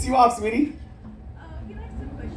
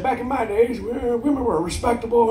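put-on old-timer voice; 4 dB quieter than usual. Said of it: "they tell me shit".

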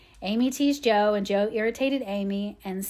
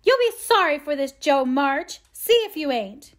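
put-on raised, louder childish threat; high, loud warning of revenge; threatening; 5 semitones higher, 6 dB louder than usual. A narrator's reading. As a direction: call a threat after someone leaving. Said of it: "in a threatening tone".

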